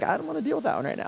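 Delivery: soft, fast muttered dismissal; wife brushing off. Retold or imitated. Wife skips a hard Bible passage, soft and quick. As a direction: wave the passage away under your breath. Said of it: "she's like".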